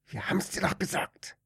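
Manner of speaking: hissed reproach. Acted. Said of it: "wurde er angezischelt".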